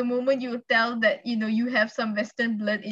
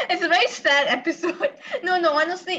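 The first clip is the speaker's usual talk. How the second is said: laughing while talking; 7 semitones higher, 3 dB louder than usual. Real, not acted. Laughs while calling it sad.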